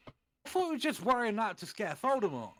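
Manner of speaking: high, loud indignant complaint; angry shout. Acted. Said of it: "shouted angrily".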